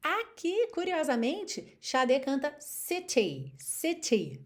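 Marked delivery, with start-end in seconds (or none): 1.8-4.4 "city" with a British t; British accent. Shown as a demonstration. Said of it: "bem britânico mesmo".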